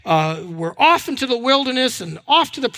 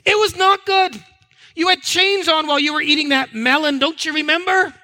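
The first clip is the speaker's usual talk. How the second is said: exasperated Moses rebuttal; 7 semitones higher than usual. Acted. Moses correcting the people he leads out of slavery, exasperated. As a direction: flatly deny their rosy memory, pitched high. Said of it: "Moses, who is leading the people out".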